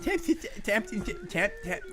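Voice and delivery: put-on stammering babyish read, squeaky breaks; baby talk. Asked to talk like a baby, host stammers the question babyishly. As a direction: stumble through it like a toddler. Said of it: "Talk like a baby".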